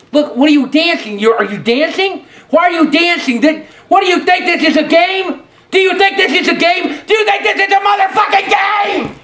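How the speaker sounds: from annoyed to high furious screaming; building rage. Acted. Rage building at dancing player.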